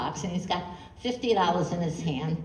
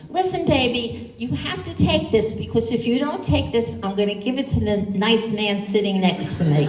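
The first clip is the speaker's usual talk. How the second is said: put-on higher, sing-song coaxing of a baby; mildly threatening a fussy baby, voice raised high; mother coaxing baby; 4 semitones higher, 7 dB louder than usual. Mother on the bus coaxing her baby, higher sing-song.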